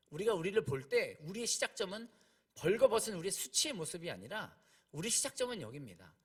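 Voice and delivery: normal talking voice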